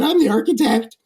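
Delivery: put-on high, cracking teen-boy voice; puberty voice. Architect answering the mobster in a high squeak. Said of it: "in my puberty voice".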